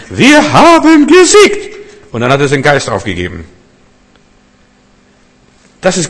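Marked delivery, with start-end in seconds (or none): 0.1-1.8 very high, loud and slow shout; stretched-out triumphant yell; marathon runner's victory cry. Acted. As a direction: cry out the news with your last strength. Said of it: "was der Marathonläufer sagen konnte".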